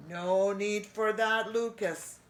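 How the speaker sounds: simper like a sly host; simpering pirate rat. A narrator's reading.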